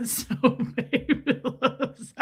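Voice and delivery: high, loud laughing fit; words squeezed out through laughter; helpless laughter. Real, not acted. Host cracking up at a comment, helpless laughing.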